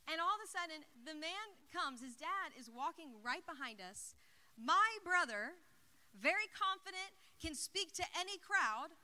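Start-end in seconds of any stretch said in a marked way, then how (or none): none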